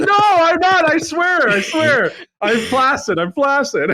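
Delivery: high pleading voice. Retold or imitated. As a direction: plead frantically. Friend protesting to staff.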